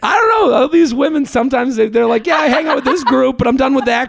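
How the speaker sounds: high, chirpy valley-girl imitation; mock a chatty woman, high; high girly voice.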